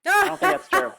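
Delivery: incredulous protest. Real, not acted. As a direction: push back in amused disbelief. Reacting to a stereotype claim.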